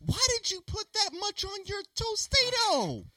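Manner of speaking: high, loud disbelief; outraged and amazed; incredulous. Real, not acted. Friend overdid the sauce, disbelief.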